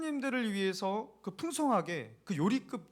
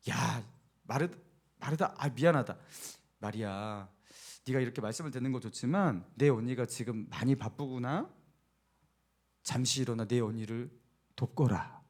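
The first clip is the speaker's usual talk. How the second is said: imagined gentle Jesus; 7 semitones lower, 5 dB quieter than usual. Pretend. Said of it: "예수님께서 이렇게 말할 것 같아요".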